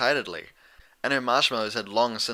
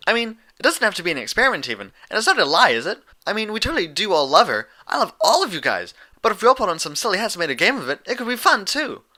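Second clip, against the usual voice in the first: high, loud and fast excited pony voice; bubbly Pinkie Pie; 10 semitones higher, 6 dB louder, faster than usual. A narrator's reading. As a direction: babble it out, bouncing with glee. Pinkie's rapid bubbly speech.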